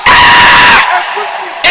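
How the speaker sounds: sharp unvoiced yell of disgust. Preacher reacting to girl's smelly armpit, disgusted yell. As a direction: recoil from the smell out loud.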